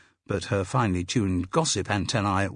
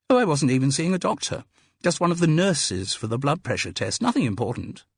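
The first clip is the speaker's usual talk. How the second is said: higher, quick brush-off; dismissive Carol; 7 semitones higher, 3 dB louder than usual. Acted. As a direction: wave the question away lightly, pitched up.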